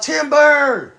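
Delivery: the boastful axe calls out as the tree falls; axe yelling. Retold or imitated. Preacher voicing the axe, mock shout. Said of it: "The axe start yelling out".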